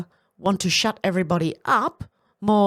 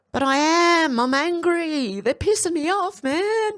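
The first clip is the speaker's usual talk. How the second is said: real anger rising higher in pitch, the words held out; openly angry; 8 semitones higher than usual. Real, not acted. To viewers about the debate, higher and angrier. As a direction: let the anger out, pitched up high. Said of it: "I'm getting angry".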